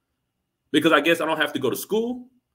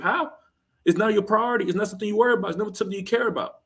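incredulous disbelief; 6 semitones higher, faster than usual. Real, not acted. Incredulous rant at women.

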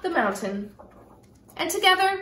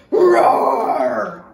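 much lower, loud bellowing roar; big bear roar; 14 semitones lower, 8 dB louder than usual. Acted. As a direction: let the bear bellow at the door. Bear at the girl's door.

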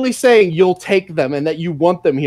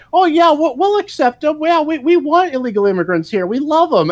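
much higher, sugary mock-welcoming voice; mock Democrat voice; 10 semitones higher than usual. Mocking the Democrats welcoming migrants, in a high syrupy voice. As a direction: play the smug welcome, pitched way up. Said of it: "they went from".

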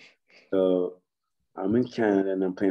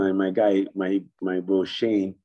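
ordinary talk

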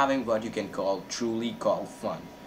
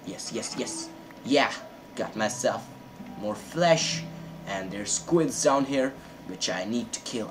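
usual speaking voice

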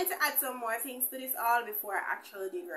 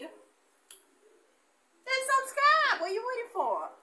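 very stretched, much higher and louder; drawn-out playful call; 13 semitones higher, 6 dB louder, much slower than usual. Real, not acted. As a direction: sing it out to the viewers.

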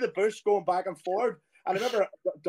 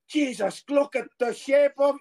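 shocked disbelief; 8 semitones higher, slower than usual. Retold or imitated. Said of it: "he couldn't believe it".

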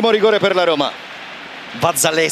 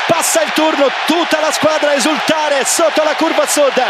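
breathless italian goal commentary; 7 semitones higher than usual. Real, not acted. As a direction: roar the winning goal. Winning penalty called live.

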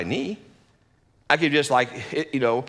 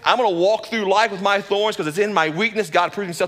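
excited resolve; 6 semitones higher, 4 dB louder than usual. Retold or imitated. Paul's new attitude voiced.

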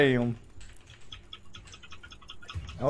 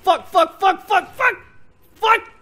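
higher, frantic repeated swearing; panicking gamer; 8 semitones higher, 4 dB louder than usual. Real, not acted. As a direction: panic as you go down. Getting killed, frantic swearing.

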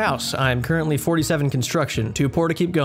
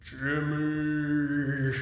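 ghost voice; 7 dB quieter than usual. Acted. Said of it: "Why are you doing the ghost voice?".

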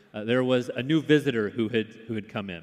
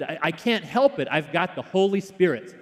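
excited; 6 semitones higher, 4 dB louder than usual. Retold or imitated. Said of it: "To which she responded".

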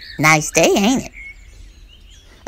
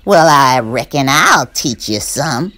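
slow, louder menace; threatening rabbit; 5 dB louder than usual. Acted. Burr Rabbit threatening the Tar Baby, slow and louder. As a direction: drag it out as a warning.

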